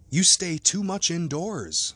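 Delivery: high, slow, emphatic friendly scolding; well-meaning lawyer's reproach; hearty chiding. A narrator's reading. Lawyer heartily chides his sick friend.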